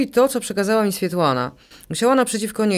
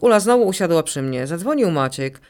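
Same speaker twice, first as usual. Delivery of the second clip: ordinary delivery, nothing marked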